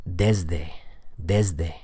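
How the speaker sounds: "desde" with the S buzzed as Z before D; voiced S. Shown as a demonstration. Teacher showing assimilation inside a word, "desde" with voiced S. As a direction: say "desde" with a Z in the middle. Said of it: "The S there is being voiced in my pronunciation".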